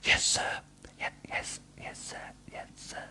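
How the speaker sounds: breathless fading whisper. A narrator's reading. Frightened employee answers the angry boss, fading whisper. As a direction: whisper it, fainter each time. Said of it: "he whispers".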